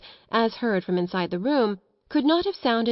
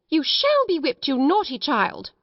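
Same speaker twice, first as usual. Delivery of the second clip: high, indignant threat; almost thundering scolding; 7 semitones higher, 3 dB louder than usual. A narrator's reading. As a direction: scold a child with rising fury. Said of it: "voice rose until it almost thundered".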